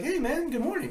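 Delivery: high, bright-voiced friendly hello; cheery stranger greeting. Stranger greeting him at the fishing spot, high friendly hello. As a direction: greet him like an old pal.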